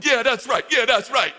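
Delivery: mock punk agreeing; little punk sidekick. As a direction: cheer on the bully like a little punk. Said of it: "I just picture him as a little punk".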